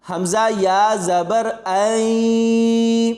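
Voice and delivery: letters spelt out, ending on a long nasal ऐ; slow spelling of हमज़ा या ज़बर, ऐ drawn out nasally; nasal ghunna. Shown as a demonstration. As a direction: hold ऐ through the nose. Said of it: "यहाँ पर गुन्ना".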